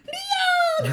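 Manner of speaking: loud high delighted call; excited shout. Retold or imitated. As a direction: yell the name like a fan. Retold fan calling out.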